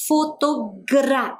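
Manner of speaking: FOTOGRA in slow separate syllables, leaning on gra. Shown as a demonstration. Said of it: "olha o som". (Fotogra dito devagar, destacando a sílaba gra)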